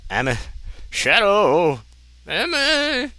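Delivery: loud high mock climax cries; hammed-up cries. Reading a bad fanfic sex scene aloud, mock cries. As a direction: shout the names way up high, overacted.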